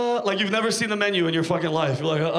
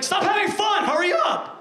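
high, exasperated shout; acted angry yell; 10 semitones higher than usual. Waiting customer yelling at staff who are having fun, exasperated. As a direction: holler it in mock fury, pitched way up.

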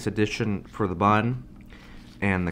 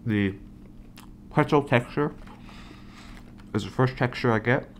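chewing while talking; speaking between bites of burger; mouth partly full; 4 semitones higher than usual. Real, not acted. Seen 0: Bit pretzel burger earlier; still holding it, jaw working while talking.

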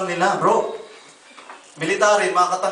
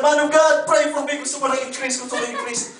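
mock eager woman begging for more; excited pleading; 8 semitones higher than usual. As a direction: rush up and plead for the raise. Woman running to pastor begging.

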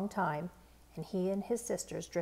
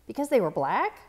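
louder, shocked question; disbelieving; 3 semitones higher, 9 dB louder than usual. A narrator's reading. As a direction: ask in stunned disbelief. Child's disbelief at racism.

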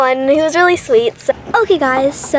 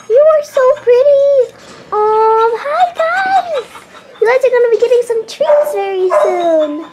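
talking to shelter dogs, high squeaky coo; 9 semitones higher than usual. Real, not acted.

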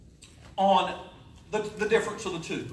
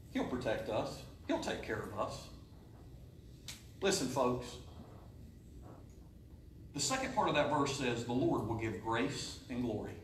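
much lower and softer, reassuring; soft tender preaching; 9 semitones lower, 10 dB quieter than usual. Acted. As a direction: hush the room and comfort them.

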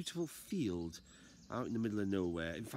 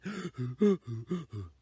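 loud breathless gasp; gasping for air; 3 semitones higher, 7 dB louder than usual. Acted. Out of breath man running down the road, loud gasp. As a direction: gasp like an unfit runner. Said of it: "he was gasping for air".

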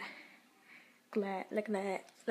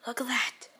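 whispering; 3 semitones higher, 4 dB louder than usual. Real, not acted. Whispering at storm.

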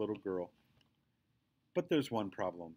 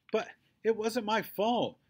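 much higher, louder, indignant; whiny protest; 11 semitones higher, 6 dB louder than usual. A narrator's reading. Girl protesting to Daddy about paying, loud and whiny. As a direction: protest it's unfair.

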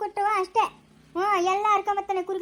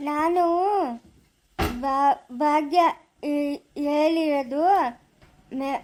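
halting stutter with long gaps between words; slow, laboured stammer; 3 semitones lower, much slower than usual. Acted. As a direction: struggle out each word, catching on first syllables. Same young man stammers his answer.

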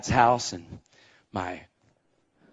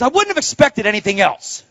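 sarcastic wife; 10 semitones higher, 7 dB louder than usual. Retold or imitated. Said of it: "She goes".

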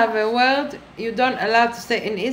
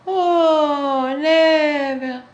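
higher, louder Oh, never; mock scandalised; 5 semitones higher, 3 dB louder, much slower than usual. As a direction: gasp it out as if shocked.

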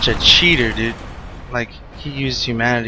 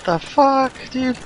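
high, startled outburst; 10 semitones higher than usual. Real, not acted. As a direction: caught off guard by the attack. Surprised by the Silver Knight.

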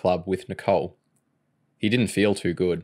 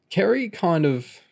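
slow, higher-pitched awkward admission; blurted confession; 10 semitones higher, slower than usual. A narrator's reading. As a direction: start confessing and falter. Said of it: "he blurted out something he'd never admitted".

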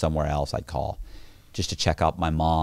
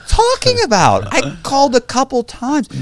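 much higher, louder playful outrage; mock indignant; 10 dB louder than usual. Real, not acted. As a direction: protest your innocence in jest. Mock defending himself against the laugh.

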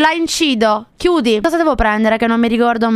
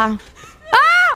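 piercingly high shriek; startled scream. Real, not acted. Streamer tubing bodies in the game, startled scream. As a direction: scream out in sudden fright. Jump scare while playing.

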